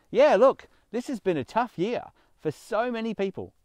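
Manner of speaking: sudden loud, high "Yeah, look"; loud burst. Real, not acted. Preacher bursting out loudly to his congregation. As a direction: burst in loudly, then ease back.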